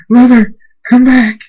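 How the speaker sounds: high, loud, pleading cry; desperate shout. A narrator's reading. Thunderstorm calls departing mother back, desperate shout. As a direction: shout after someone who is leaving.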